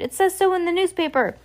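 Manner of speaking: high-pitched, insistent justification; insistent shopkeeper. A narrator's reading. Shopkeeper justifies the accusation to the children, insistent.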